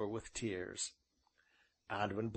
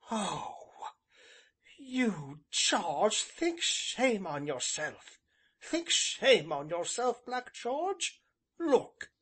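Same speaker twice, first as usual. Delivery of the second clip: put-on higher, indignant young woman; slow, emphatic scolding that climbs higher; scolding girl's cry; 8 semitones higher, slower than usual. A narrator's reading. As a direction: shame him in a raised girl's voice. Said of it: "she cried".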